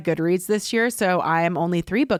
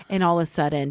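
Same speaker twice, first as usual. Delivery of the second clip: ordinary talk, nothing marked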